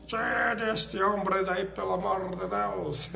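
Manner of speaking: slow, drawn-out pretend begging; mock plea. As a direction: plead in jest, stretching the words. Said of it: "fale assim".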